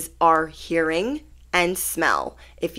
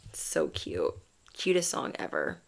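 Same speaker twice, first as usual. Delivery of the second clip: a breathless whisper, barely there; whispered aside; 9 dB quieter than usual. Real, not acted. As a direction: lean in and whisper it. Whispered aside about song.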